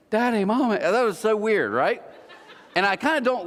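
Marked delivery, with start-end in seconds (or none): 0.1-0.8 higher mock-British "Daddy, Mommy"; teasing British accent; touch of British accent. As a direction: mimic British "-ay" endings, pitched up. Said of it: "with, like, a touch of, like, a British accent".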